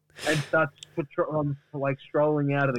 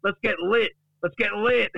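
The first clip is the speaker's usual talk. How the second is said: high, animated comic boasting; hyped-up Duck; 7 semitones higher than usual. Acted. Duck explains his new slang to Gary, hyped-up boast. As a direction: pitch it up, cocky and excited. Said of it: "And he said".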